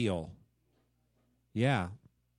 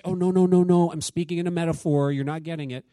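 louder, hammed-up "oh no no no" backpedal; mock correction; 7 dB louder than usual. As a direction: loudly overplay an apologetic take-back. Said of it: "Jesus says".

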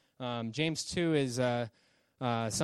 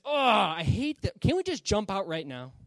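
exasperated; 6 semitones higher, 5 dB louder than usual. Acted. Passenger with coffee spilled on his lap, acted exasperation. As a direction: groan it, itching to jump.

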